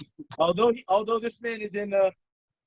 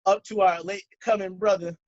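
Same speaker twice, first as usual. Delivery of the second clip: ordinary delivery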